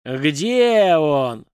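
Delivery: loud, drawn-out roar; explosive high-pitched bark at a captive. A narrator's reading. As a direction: bellow the demand, stretching the words. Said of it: "рявкнул дракон".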